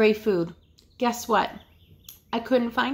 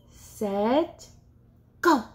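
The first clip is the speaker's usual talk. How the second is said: normal talking voice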